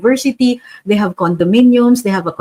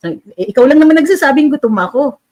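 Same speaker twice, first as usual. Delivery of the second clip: mock retort; 5 semitones higher, 5 dB louder than usual. Retort voiced as a poor citizen.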